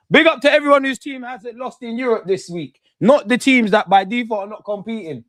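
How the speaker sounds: loud, high-pitched call to the crowd; loud shout-out. Real, not acted. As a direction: project it like a teasing announcement. Mock shout-out to viewers.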